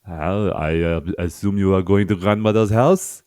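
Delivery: hammed-up French accent as the Big Bad Wolf; sly wolf with a mock French accent, teasing. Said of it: "French Big Bad Wolf".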